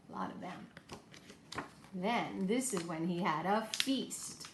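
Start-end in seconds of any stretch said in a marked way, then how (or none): 0.1-1.6 soft breathy whisper, slowed down; whispered aside. Acted. Teacher whispering a playful aside to her class. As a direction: lean in and whisper it to the kids.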